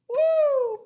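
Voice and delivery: a very high, loud whoop of delight; delighted woo. Acted. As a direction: gasp with joy at the picture. Delighted at purple cat.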